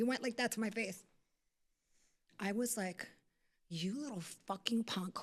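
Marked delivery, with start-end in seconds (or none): 3.7-5.2 soft, menacing telling-off; quiet, seething put-down. Retold or imitated. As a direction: drop the volume and let the contempt show. Confronting him after face touch.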